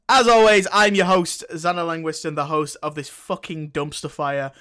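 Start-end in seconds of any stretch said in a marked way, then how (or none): none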